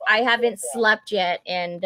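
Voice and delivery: ordinary talk, nothing marked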